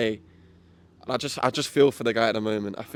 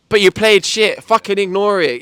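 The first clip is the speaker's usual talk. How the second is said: exasperated telling-off; 7 semitones higher, 9 dB louder than usual. Pretend. Ranting at Bellingham.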